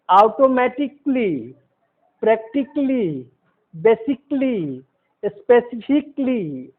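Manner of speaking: "ऑटोमेटिकली", "प्रैक्टिकली", "basically", "स्पेसिफिकली" all ending in klee; slow model list, no a before "ly" in each word ending "कली"; silent A. Shown as a demonstration. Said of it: "ये उच्चारण होना चाहिए".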